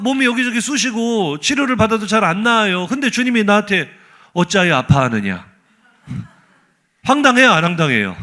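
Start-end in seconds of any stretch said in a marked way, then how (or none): 4.3-5.5 low, imitated scolding question; hypothetical rebuke in a lower voice; mock rebuke. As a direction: deliver a lowered, solemn mock reproach. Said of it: "꾸중이라고 하시니".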